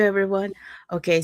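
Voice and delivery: ordinary delivery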